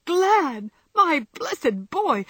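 high, loud burst of delight; overjoyed. Acted. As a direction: gush with joy, voice soaring. Jo overjoyed at Laurie's return.

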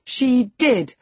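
high, loud, emphatic contradiction; crying out insistently. A narrator's reading. Young lady contradicting her cousin, crying out. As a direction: insist hotly, pitch jumping up. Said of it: "cried my young lady".

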